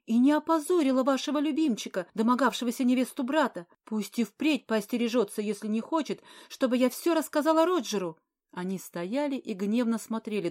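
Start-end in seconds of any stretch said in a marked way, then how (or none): 0.1-8.2 much higher, loud furious tirade. Acted. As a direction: lash out, voice climbing high. Furious quarrel with countess.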